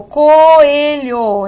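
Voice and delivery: "coelho" stretched out as co-e-lho; syllables separated. Shown as a demonstration. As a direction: slowly pull apart the vowels of "coelho". ("coelho" devagar, separando as sílabas)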